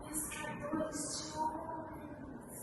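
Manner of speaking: slow, hushed and puzzled; quiet musing. Acted. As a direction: mull the words over softly. Pondering the strange phrase.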